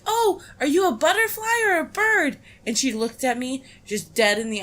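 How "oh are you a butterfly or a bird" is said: put-on high, cooing talk to a child; sing-song aunt voice. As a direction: coo it like a doting aunt. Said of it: "I was like".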